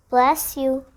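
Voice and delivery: plain reading voice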